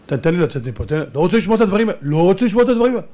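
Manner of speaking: fast pleading voice; man fleeing a sermon he won't hear, fast; hurried escape. Retold or imitated. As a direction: rush out the words to get away. Listener flees the sermon.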